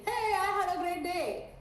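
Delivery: loud, high, slow and bubbly; high energy. Shown as a demonstration. Sales coach showing a high-energy client. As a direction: burst out with big energy. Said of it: "my energy levels are higher".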